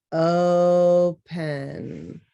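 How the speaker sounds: "Open" stretched slowly into two syllables, o-pen; each syllable of "Open" drawn out. Shown as a demonstration. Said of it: "two syllables".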